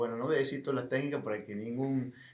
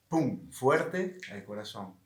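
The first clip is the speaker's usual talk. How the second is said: ordinary talk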